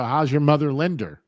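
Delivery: "Linder" ending in a clear R; Linda with added R. Retold or imitated. People asking after his mother, "Linder" with intrusive R. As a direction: end "Linder" on an R sound, imitating others. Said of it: "Where the frick does the R come".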